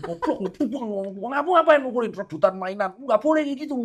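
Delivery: half-laughing mock telling-off of his son; mock scolding dad. Dad scolds son after fight.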